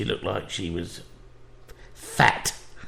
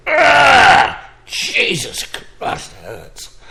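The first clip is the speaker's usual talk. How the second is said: pained cry; 9 semitones higher, 7 dB louder, much slower than usual. Retold or imitated. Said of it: "I'm going".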